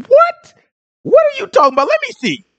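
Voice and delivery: high-pitched mock disbelief; incredulous. Teacher acting a listener hearing a wild claim, incredulous. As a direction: squeak it out like a baffled listener.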